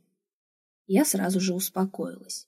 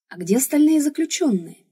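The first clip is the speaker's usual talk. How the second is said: higher-pitched, fearful asking; timid question; 7 semitones higher than usual. A narrator's reading. Heroine asking the giant about the other prisoners, timid high voice. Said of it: "боязливо спросила я".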